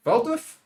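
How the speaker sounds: Valdriv sounded out with a questioning rise; tentative attempt at the name Valdriv; unsure pronunciation. Real, not acted. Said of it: "I'm gonna pronounce these, these, these names like, uh, uh, like I assume".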